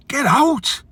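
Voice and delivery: high, sharp dismissal; mock get out. Lad tells coughing bloke to leave, high scornful snap. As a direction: shoo him off in disgust.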